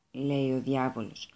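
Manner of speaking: plain reading voice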